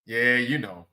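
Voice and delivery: usual speaking voice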